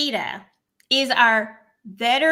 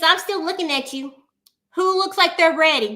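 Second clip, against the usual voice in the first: normal talking voice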